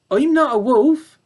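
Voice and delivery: high, bright little pig voice; young pig. Acted. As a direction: protest innocently like a small piglet. Babe's voice.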